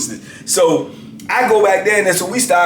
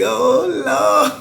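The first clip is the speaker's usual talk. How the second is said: laughing disbelief; 10 semitones higher than usual. Real, not acted. Narrator reacting in disbelief.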